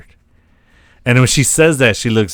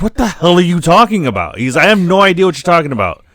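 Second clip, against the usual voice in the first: playing dumb; 6 semitones higher, faster than usual. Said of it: "Oz keeps playing dumb".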